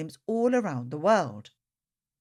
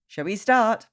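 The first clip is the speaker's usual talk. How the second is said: plain reading voice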